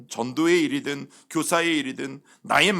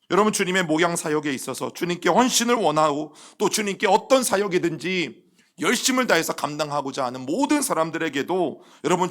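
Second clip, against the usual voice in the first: ordinary delivery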